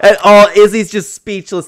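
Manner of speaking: loud, slow words through real laughter; cracking up while describing the clip; laughing. Real, not acted. Host laughing at the fighter's silence.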